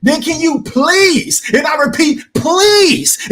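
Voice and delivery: high, drawn-out plea; Stephen Brimley voice. Acted. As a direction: stretch the begging words up high. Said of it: "in my Stephen Brimley voice".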